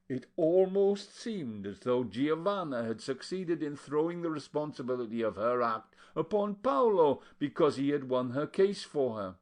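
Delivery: usual speaking voice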